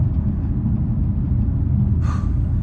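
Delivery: long, heavy exhale; deep breath. Real, not acted. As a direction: let out a big breath to recover. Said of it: "Need a fucking deep breath".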